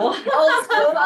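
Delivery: amused laughing echo. Real, not acted. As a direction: laugh the words out. Repeating colleague's phrase, laughing.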